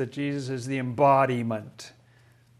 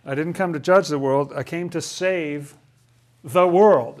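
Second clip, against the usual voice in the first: "judge" and "world" pushed hard, "world" much louder; emphatic reading; 5 dB louder than usual. Shown as a demonstration. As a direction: build to a loud "world".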